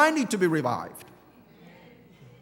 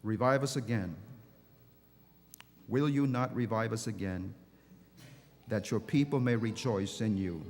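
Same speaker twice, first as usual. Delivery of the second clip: low, soft, prayerful plea; quiet prayer; 11 semitones lower, 9 dB quieter than usual. Acted. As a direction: draw them in gently. Soft closing prayerful appeal.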